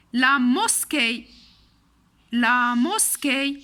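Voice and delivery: "La mosquée" loud and drawn out, final é held; emphatic, stretched teacher's model; slow model word. Shown as a demonstration. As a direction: sound out "La mosquée" syllable by syllable. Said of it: "il se prononce toujours E".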